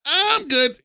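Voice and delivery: high-pitched pretend denial; mock innocent contestant. Contestants refusing to confess to Lana, mock-innocent high voice. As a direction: wave it off in a put-on high voice. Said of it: "And they'll be like".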